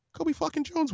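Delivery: very high-pitched, hushed amazement; awestruck. Real, not acted. Fan telling how a soccer legend appeared, awestruck. Awed climax of fan story.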